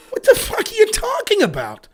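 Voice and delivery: high-pitched, incredulous outburst; exasperated retort. Real, not acted. Host snapping back at online lefties, exasperated. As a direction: throw it back in disbelief.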